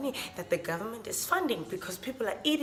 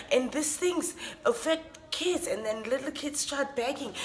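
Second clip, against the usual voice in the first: ordinary talk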